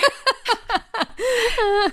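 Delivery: high, loud burst of laughter; really cracking up; big laugh. Real, not acted. Wife laughing at her husband's chicken house story, high and loud.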